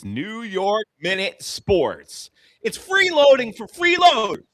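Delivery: high, loud hammed-up TV announcer; announcer intro. Podcaster mocks himself with a fake sports-show intro, hammed-up announcer. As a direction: boom it out like a cheesy show intro.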